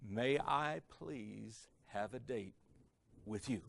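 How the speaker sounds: very slow and soft, each word savoured; theatrical, polished request. Retold or imitated. Joe asking her a third time, like a Shakespearean actor. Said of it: "a Shakespearean actor couldn't have said it".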